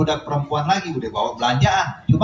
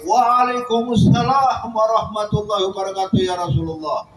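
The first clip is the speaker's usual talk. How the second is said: higher, ringing answer in unison; called out together; 6 semitones higher than usual. Retold or imitated. Whole household finally answering the Prophet, calling out. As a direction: call the reply out all at once. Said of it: "serempak satu rumah tuh teriak".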